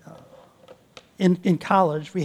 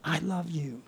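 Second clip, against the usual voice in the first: soft tender; 11 dB quieter than usual. Shown as a demonstration. Telling your children.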